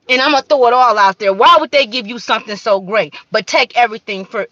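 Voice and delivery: loud, fast, high-pitched preaching; fired-up rant. Real, not acted. Calling out the world in a loud, fast rant. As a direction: throw it all out there, quick and fierce.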